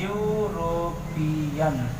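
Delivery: European drawn out very slowly and softly; teacher models the word slowly for the class. Shown as a demonstration. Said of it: "European बोलने से क्या आ रहा है?".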